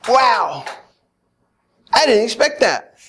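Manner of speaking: usual speaking voice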